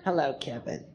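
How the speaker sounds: low, hushed weirdo greeting; weirdo character. Acted. Comic plays talking hands greeting, low soft weirdo. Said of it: "It's just a fucking weirdo".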